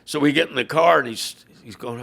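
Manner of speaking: ordinary talk, nothing marked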